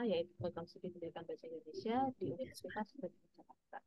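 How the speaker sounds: faint voice far from the mic; soft, barely audible self-introduction; too quiet. Real, not acted. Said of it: "suaranya kok kecil ya".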